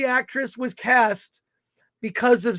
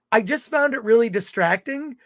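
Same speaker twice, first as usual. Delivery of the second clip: usual speaking voice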